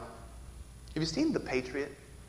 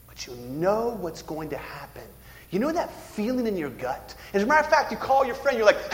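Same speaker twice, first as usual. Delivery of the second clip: ordinary talk, nothing marked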